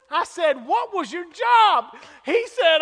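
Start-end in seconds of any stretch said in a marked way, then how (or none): 0.7-1.9 high, loud, stretched-out question; urgent questioning. Retold or imitated. Preacher retelling how he pressed Mister James about his job, urgent questioning. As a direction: call it out slowly, desperate for the answer.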